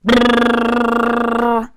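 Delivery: loud, high sustained trilled ring; piercing rolled brrr; alarm clock. Acted. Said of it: "went the alarm clock".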